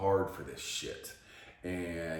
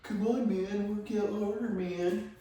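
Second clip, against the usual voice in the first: much higher mocking trainer voice. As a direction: parody the paid coach cheering. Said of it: "someone to be like".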